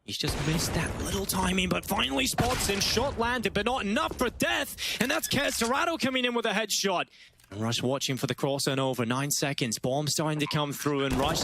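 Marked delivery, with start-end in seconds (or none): 1.7-7.0 excited call. Real, not acted. Casting a tense clutch fight.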